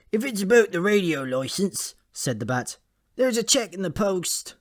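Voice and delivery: lightly squeaky high bat voice, trying to sound innocent; a jittery little bat making excuses; nervous bat. Acted.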